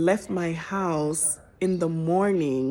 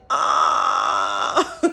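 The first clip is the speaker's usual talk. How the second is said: 1 exasperated gasp; 6 semitones higher than usual. Real, not acted. Panicked at GPS arrival time.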